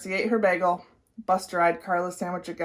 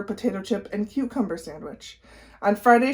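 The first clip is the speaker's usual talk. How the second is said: usual speaking voice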